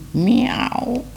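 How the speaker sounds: put-on high, far-off meow from an old cat. A narrator's reading. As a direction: meow like a cat answering from the house. A cat answers from the house.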